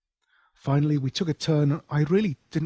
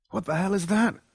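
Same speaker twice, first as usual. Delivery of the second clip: frightened; 9 semitones higher than usual. Acted.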